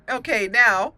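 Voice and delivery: usual speaking voice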